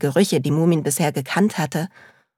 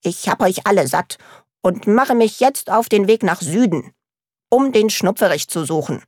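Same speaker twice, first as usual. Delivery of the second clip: stern; 3 semitones higher, 3 dB louder than usual. Acted. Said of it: "teilte Mumin dem Leuchter streng mit".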